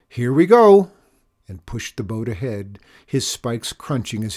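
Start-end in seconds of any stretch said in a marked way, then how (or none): 0.1-0.9 loud, high launching shout. A narrator's reading. Chester pushing the boat off with his passenger, shouting. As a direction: shout it out over the ice. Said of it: "Instead, he shouted".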